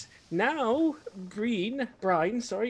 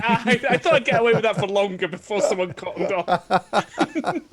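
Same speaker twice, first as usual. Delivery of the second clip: laughing and loud, caught out; giggling confession; 3 semitones higher, 7 dB louder than usual. Real, not acted. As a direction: laugh through it, busted.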